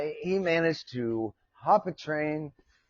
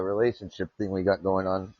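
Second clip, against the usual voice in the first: ordinary talk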